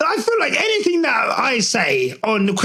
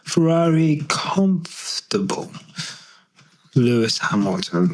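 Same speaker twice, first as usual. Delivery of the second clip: whisper; 6 semitones lower than usual. Real, not acted. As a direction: mouth it while typing. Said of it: "Hold on".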